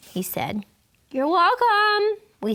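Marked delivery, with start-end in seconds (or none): 1.1-2.2 put-on polite kids chorus. Class answers politely together.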